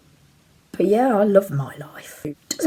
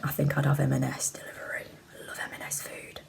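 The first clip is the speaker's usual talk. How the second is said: whispering; 4 semitones lower, 13 dB quieter than usual. Real, not acted. Quiet aside about food.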